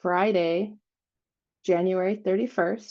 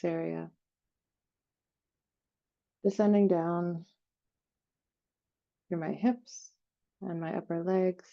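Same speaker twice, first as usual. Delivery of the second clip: guided meditation; 3 dB quieter than usual. Real, not acted. Host leading listeners through an energy cleansing, guided meditation. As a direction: softly guide them down through the body, phrase by phrase.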